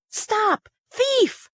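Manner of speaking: high, urgent cry of alarm at little extra volume; high-pitched yell. A narrator's reading. Diner at a table spots a cake thief, high-pitched yell. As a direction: call it out high, raising the alarm. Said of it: "Somebody at a table yelled".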